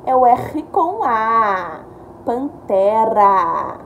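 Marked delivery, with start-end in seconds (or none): 2.2-3.8 Pantera stretched very slowly; slow blending. Shown as a demonstration. Teacher blending syllables for young readers. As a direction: draw the word out syllable by syllable. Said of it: "blending syllables into word".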